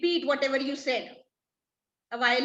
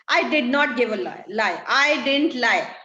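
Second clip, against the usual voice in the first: louder, insistent angry denial; 6 dB louder than usual. Acted. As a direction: hammer home that you never lied.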